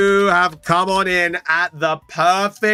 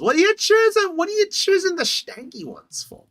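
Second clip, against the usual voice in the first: high, slow teasing complaint; high singsong telling-off; high mock scolding; 9 semitones higher, slower than usual. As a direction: squeal it like a whiny scold. Mock-scolding pack choices.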